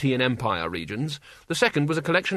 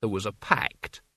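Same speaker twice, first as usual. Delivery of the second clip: slow, lower, barely doubtful; faint doubt; 4 semitones lower, 4 dB quieter than usual. A narrator's reading. Albert reminds Mort of the pact, faintly doubtful. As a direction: assert it with the barest wobble of confidence. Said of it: "barest gnat song of doubt in his voice".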